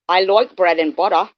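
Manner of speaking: a second, bolder go at Australian, "like" turned to "loik"; Australian accent. Shown as a demonstration. Streamer tries Australian again for chat, "like" as "loik". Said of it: "Am I getting better at the".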